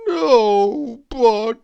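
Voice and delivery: slow, loud, drawn-out moan; long melancholy character wail; mournful, melancholy. A narrator's reading. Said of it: "mournful, melancholy sort of a voice".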